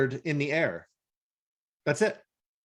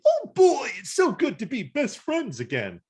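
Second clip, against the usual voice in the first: much higher, gushing cartoon voice; mock Rat Trap; 11 semitones higher, 3 dB louder than usual. Mimicking Rat Trap's cheesy reunion, high and gushing. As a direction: gush it like a goofy sidekick. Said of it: "Rat Trap's like".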